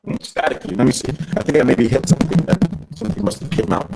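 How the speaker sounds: crackly, staticky phone line; distorted over a bad line; staticky connection. Real, not acted. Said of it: "it still sounds a little bit, uh, staticky".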